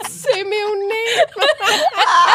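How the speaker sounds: high, slow, laughing shout; cracking up while blurting it out. Real, not acted. Laughing at own surname.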